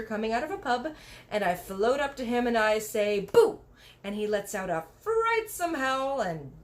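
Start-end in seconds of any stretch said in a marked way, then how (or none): 3.2-3.6 loud "Boo!" to scare; scary boo. A narrator's reading. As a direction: leap out and spook him. Scaring the teacher.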